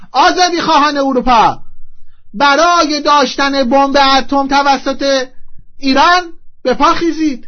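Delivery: loud mock-solemn appeal to the masses; rallying cry. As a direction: proclaim it like a rally slogan, stretching the words. Rallying call to Europe's freedom seekers.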